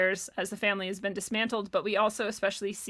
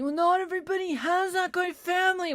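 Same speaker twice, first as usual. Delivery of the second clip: mocking quote; 8 semitones higher, 3 dB louder, faster than usual.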